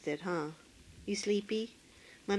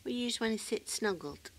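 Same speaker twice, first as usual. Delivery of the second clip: ordinary talk, nothing marked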